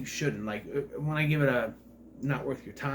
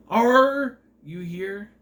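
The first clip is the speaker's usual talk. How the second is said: higher, louder and slow, with "Are" and "here" given heavy American R's; a mocking slow demo of overworked R sounds; overdone American R's; 6 semitones higher, 6 dB louder, much slower than usual. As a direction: imitate an Aussie actor forcing American R's. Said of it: "they say their R's, but you know that they're not supposed to say it".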